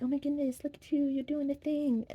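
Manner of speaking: high gushing wife voice; imitating wife. Vlogger mimics his wife praising him, high gushing voice. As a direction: coo it like an adoring wife catching you. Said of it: "come to me and be like".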